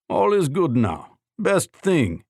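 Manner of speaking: lightly voiced Russian accent, pleased; a hint of a Russian man praising his mended nose. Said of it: "he said with his Russian accent".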